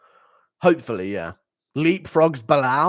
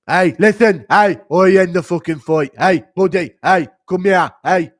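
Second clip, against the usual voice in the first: loud, high angry yelling; impression of an angry referee, much louder; 4 semitones higher, 11 dB louder than usual. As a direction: bark at the fighter to come here. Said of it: "J D M and Goddard arguing".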